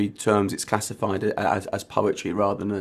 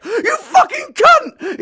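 shrieking, slow and much higher scream; 12 dB louder, much slower than usual. Acted. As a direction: scream it at full force. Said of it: "he screamed".

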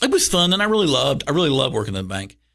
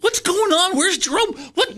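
put-on much higher, bewildered kid voice; hammed-up alarm, pitched way up; mock panic. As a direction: act lost and confused. Kids panicking, can't find Jerome.

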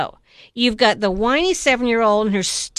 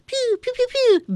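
laser sounds; 13 semitones higher, faster than usual. Retold or imitated. Imitating laser shots.

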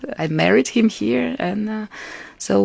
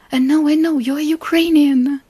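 higher, hesitant voice for the caretaker; puzzled caretaker; 9 semitones higher, 5 dB louder than usual. Retold or imitated. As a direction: voice the friend who just realised, pitched up. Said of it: "And she was like".

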